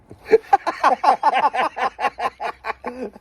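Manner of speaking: high hearty laugh; loud burst of laughter. Real, not acted. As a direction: crack up laughing. Laughing at empty club.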